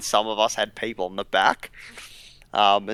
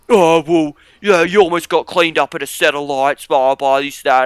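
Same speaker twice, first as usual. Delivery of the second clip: higher, louder mock cop whine; 4 semitones higher, 7 dB louder than usual. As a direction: parody the cop's lecture. Mocking the cop's reply.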